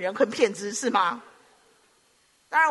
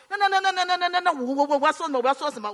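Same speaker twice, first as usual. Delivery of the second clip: higher, stuttering panic; panicked stammer; 5 semitones higher, faster than usual. Retold or imitated. Terrified friend asking what to tell the stern father, stammering. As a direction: stammer like a caught boyfriend.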